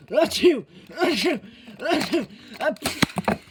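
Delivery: four loud sneezes in a row; sneezing fit. Acted. Lands in field of flowers.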